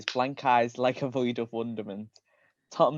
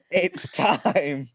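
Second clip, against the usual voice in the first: Balthazar line; 6 semitones higher, 7 dB louder than usual. A narrator's reading. Balthazar Dark line in story.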